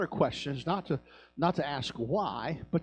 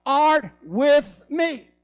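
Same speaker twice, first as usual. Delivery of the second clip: loud, high psalm recital, slowing at end; thunderous scripture quote; shouted psalm; 12 semitones higher, 13 dB louder than usual. Acted. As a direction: proclaim the verse with full force.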